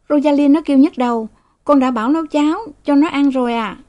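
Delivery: put-on high, fast boyish voice; boy's report. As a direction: rattle it off like a young boy. Son reports to father. (giọng cậu bé nói nhanh)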